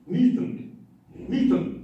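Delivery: "Milton" with the t swallowed, like "Mi'n"; glottal t in Milton. Shown as a demonstration. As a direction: drop the t in "Milton" to a glottal stop. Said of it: "Remember the word Milton?".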